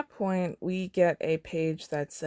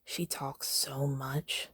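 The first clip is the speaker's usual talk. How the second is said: soft, slow sly murmur; whispered gossip; 9 dB quieter, much slower than usual. Acted. Girl from District four gossiping, softly whispered. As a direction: drop it to a hush. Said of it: "whispers again".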